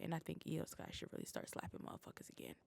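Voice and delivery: fast whispered aside; whispering. Real, not acted. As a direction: whisper it quickly under your breath. Whispered aside about Io Sky.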